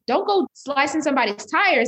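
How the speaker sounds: high, loud mock warning voice; a playful shouted aside; mock scold. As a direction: toss it off as a silly imagined quote. Mock quote of bad impulse.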